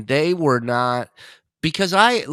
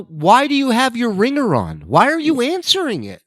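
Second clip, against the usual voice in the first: incredulous; 12 semitones higher, 5 dB louder than usual. Retold or imitated. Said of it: "And I go".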